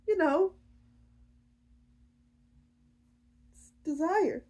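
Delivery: high, hesitant, hushed confession; small bashful voice; coy admission. Acted. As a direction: admit it sheepishly, with a long pause. Rhea coyly admits desire.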